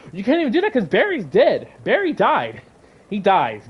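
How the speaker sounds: high, swooping exasperated protest. Real, not acted. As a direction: burst out in frustrated disbelief. Exasperated at plot he is retelling.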